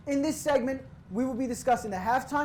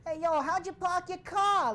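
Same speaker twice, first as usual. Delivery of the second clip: hammed-up Boston accent, pitched higher; mock Boston accent; 7 semitones higher than usual. Host imitates the commercial's Boston accent to his co-host, in a mock Boston accent. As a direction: toss it off like a Boston guy in the ad. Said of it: "the whole commercial involving the Boston accents".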